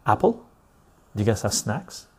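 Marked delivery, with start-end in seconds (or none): 0.0-0.4 playful high rising "Apple?"; teasing question. Real, not acted. Host teasing chat with a banned word, high rising. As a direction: tease them with the word.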